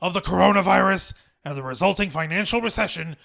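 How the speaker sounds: imitated company chairman addressing staff; put-on executive voice, measured and earnest; Vince McMahon. Shown as a demonstration. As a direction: deliver a formal statement as the impersonated boss. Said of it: "my best Vince McMahon voice".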